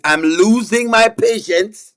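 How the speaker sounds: slow, drawn-out warning, rising at the end; losing patience. Pretend. Host warning the laughing chat, patience slowly running out. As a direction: stretch it out as a mock threat.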